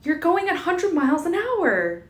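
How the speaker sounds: high, loud panicked yell. A narrator's reading. Bella yelling at Edward about the speed, high. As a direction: scream it over the engine. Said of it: "she yelled at me".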